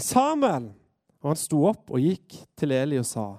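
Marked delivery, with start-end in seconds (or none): 0.0-0.7 high drawn call of a name; summoning call, falling at the end. Acted. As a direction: call him from afar, pitched well up. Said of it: "Da ropte Herren tredje gang".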